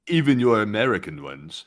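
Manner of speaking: mock British accent, measured delivery; British man. British guy adding a remark to Americans, mock British accent. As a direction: imitate a British man's condescending aside. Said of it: "And then he said".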